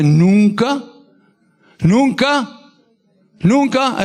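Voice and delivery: stack the nuncas, climbing; Nunca, nunca, nunca rising in pitch with each repeat; building emphatic repetition. Acted. God's promise, repeated with rising force.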